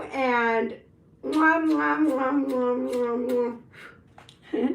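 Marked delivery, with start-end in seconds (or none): 1.2-4.8 repeated blown kisses; kiss sounds. Real, not acted. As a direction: smack kisses goodbye. Blowing kisses goodbye.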